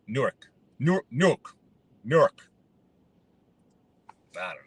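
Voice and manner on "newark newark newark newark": trying "Newark" several ways, as "Nork" and "Nuk". Shown as a demonstration. Unsure how to say it, testing versions of "Newark". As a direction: fumble through takes of "Newark", clipped and short. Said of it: "to tell us how to pronounce it".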